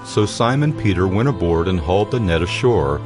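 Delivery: usual speaking voice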